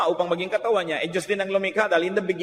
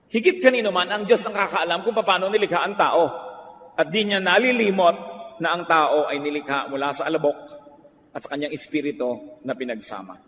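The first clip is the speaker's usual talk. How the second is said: normal talking voice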